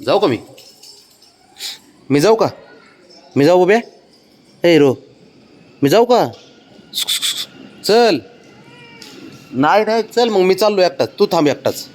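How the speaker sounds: high, loud mock threat to leave; sing-song pretend leaving; teasing threat. Real, not acted. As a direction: playfully bluff about going alone.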